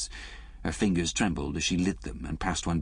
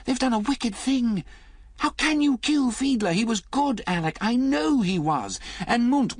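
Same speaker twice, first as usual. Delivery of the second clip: much higher and louder, pleading; crying out; 5 dB louder than usual. A narrator's reading. Liz pleading with Alec about Fiedler, loud and high. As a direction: cry out in distress. Liz's outburst continues.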